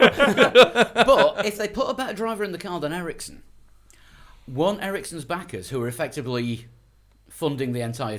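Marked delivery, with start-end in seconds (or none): 0.0-3.4 laughing. Real, not acted. Laughing question.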